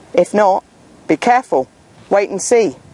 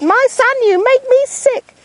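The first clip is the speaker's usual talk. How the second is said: high voiced angry mum; a mother disgusted with her son; mum scolding. Mum turns on lying son, high scolding voice.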